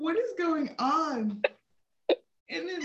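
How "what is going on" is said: higher, louder and slow; baffled question. Retold or imitated. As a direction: drag out the question in disbelief. Disbelief at the news.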